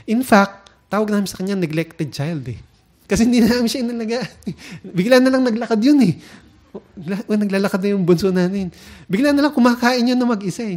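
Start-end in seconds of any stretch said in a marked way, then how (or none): 3.1-10.8 louder, higher and fast amused telling; laughing storytelling. Real, not acted. Parent joking to the congregation about their youngest, laughing. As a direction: rush through it half laughing.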